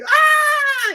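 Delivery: very high, loud scream; imitated scream. Girls fleeing the live scarecrow, mimicked high-pitched screaming. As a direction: shriek like girls running away in fright. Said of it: "they would go run screaming like".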